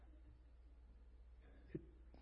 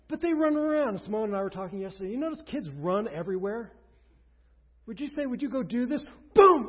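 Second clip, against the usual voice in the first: much louder and higher, excited preaching; full-force preaching; 8 semitones higher, 13 dB louder than usual. Acted. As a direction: preach it big to the whole church. Preaching about running kids.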